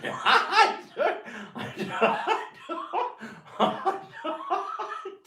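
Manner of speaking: breaking into laughter mid-phrase; trying to speak but laughing through it; real giggles swallowing the words. Real, not acted. Laughing too hard to finish.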